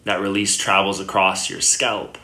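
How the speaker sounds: unhurried meditation guidance with long pauses; guided meditation. Acted. Yoga teacher guiding listeners lying down into Yoga Nidra, calm meditation voice. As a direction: settle the listener gently, leaving long silences between phrases.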